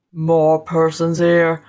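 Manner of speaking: low, slow, menacing giant; awful spirit voice. A narrator's reading. Spirit dismisses the scholar's objection, awful voice. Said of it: "said the spirit".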